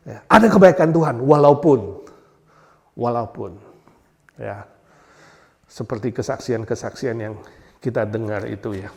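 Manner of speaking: ordinary talk, nothing marked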